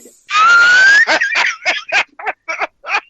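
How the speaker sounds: very high, loud shriek of laughter. Real, not acted. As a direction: scream with laughter. Hundreds of victims reveal.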